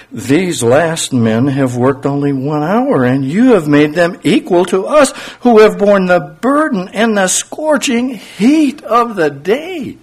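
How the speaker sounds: rising, slow aggrieved protest; grumbling complaint. Acted. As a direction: read it as a growing grievance. Grumbling workers quoted from text.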